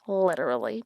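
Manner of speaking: "literally" as a quiet, rising afterthought; fading literally. Shown as a demonstration. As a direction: let "literally" trail off into a murmur. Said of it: "literally repeated".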